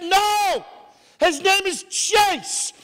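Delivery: high, slow imitation of a wife; insistent wife. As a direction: overrule firmly in a high, stretched voice. Wife overrules him, retold.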